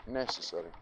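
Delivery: normal talking voice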